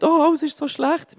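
high, drawn-out mock whine; whiny moaning. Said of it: "habe ich mega gejammert".

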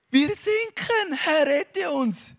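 high, slow panicked cry for help; disciples crying out. A narrator's reading. Disciples in the storm cry to Jesus in panic. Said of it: "riefen sie aufgeregt".

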